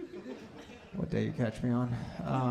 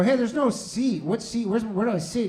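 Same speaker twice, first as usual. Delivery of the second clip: confused at gate; 7 semitones higher than usual. Retold or imitated. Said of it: "I go".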